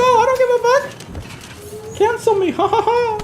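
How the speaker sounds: very high, slow, stretched taunt ending in laughter; sing-song dare with a mocking laugh; taunting singsong. Taunting haters to cancel him.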